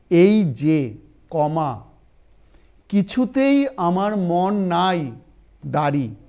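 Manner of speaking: slow, loud dictation with long pauses between phrases. Shown as a demonstration. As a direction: read it out phrase by phrase for students to write. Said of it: "আমি খুব আস্তে আস্তে বলছি". (ধীরে ধীরে শ্রুতিলিখন)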